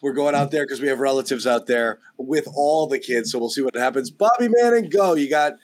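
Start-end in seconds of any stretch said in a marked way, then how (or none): 4.1-5.2 high, punchy call to the reporter. Real, not acted. Host hands the show to the reporter, calling out. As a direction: call the name out high, then hand over.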